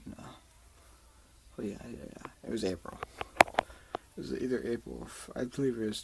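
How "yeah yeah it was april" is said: usual speaking voice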